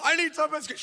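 high-pitched imitation of a wailing woman; mock hysterical crying. Crying woman outside the door begs for pastor, mock hysterical. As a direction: mimic hysterical pleading, high. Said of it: "this woman's crying hysterically".

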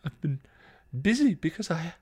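high, halting, tearful voice; faltering on the edge of crying; choked up. A narrator's reading. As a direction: choke it out between pauses. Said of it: "Buffy choked".

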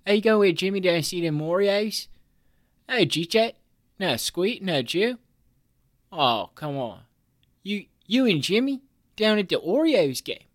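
put-on high, loud Baltimore local accent. Imitating Baltimore locals in a Baltimore accent. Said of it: "where people talk like this".

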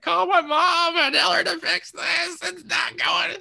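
put-on very high, shouted child's panic; hurt boy begging for his mom; panicked child. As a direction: scream it like a bleeding four-year-old. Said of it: "I'm like".

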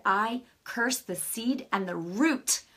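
slow, loud, building prayer command. Retold or imitated. Praying over the cyst, slow and loud commanding prayer. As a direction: pronounce it like a verdict from God.

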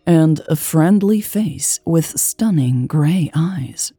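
plain reading voice

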